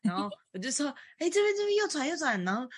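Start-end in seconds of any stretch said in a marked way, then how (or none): 1.2-2.4 high, hurried navigator calling the turn; urgent directions. Retold or imitated. Friend navigating on a scooter, calling the turn in a high voice. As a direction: call out the right turn urgently.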